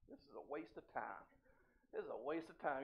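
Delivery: low, very soft grumble to himself; bored grumble. Retold or imitated. Preacher retelling his fruitless fishing trip, low bored grumble. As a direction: grumble under your breath, acted out.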